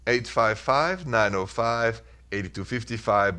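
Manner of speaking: normal talking voice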